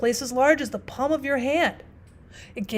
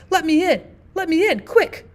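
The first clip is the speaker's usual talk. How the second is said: louder, higher, urgent cries to be let in; terrified pleading; 6 semitones higher, 7 dB louder than usual. Acted. Husband at his own door, desperate and terrified. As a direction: beg frantically at the door. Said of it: "almost breathless from terror and fatigue".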